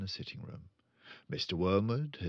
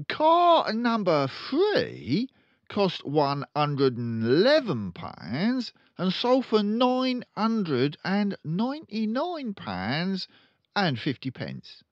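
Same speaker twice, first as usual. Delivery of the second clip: loud, high, very slow dictation; slow dictating father; 11 semitones higher, 8 dB louder, slower than usual. Acted. Father dictating sums to his son, slow and loud. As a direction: spell out each word for the boy.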